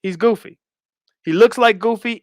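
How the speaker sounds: usual speaking voice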